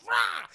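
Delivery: high loud shouted battle cry. Retold or imitated. Sydney attacking the killer, loud battle cry. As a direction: attack with a war yell. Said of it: "she goes".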